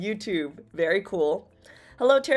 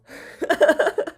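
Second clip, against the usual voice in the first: high bubbly giggle; a quick, delighted high laugh; 8 semitones higher, 6 dB louder than usual. Real, not acted. Streamer giggles at her own outburst to chat, high delighted giggle.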